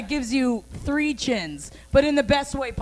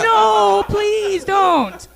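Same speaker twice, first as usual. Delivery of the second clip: mock pleading victim; 8 semitones higher, 5 dB louder, much slower than usual. Acted plea of tased man.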